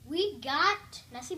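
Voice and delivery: ordinary delivery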